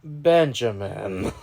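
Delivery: ordinary talk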